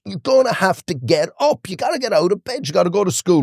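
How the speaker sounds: replaying a father losing patience with his son, half-acted; exasperated father. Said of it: "And I lost it".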